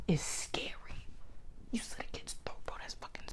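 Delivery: hushed, awed whisper; awed by the ruthless villain, whispering. Real, not acted. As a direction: whisper it like it scares you. Awed by Wonder Woman villain.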